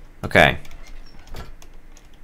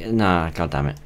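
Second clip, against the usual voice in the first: usual speaking voice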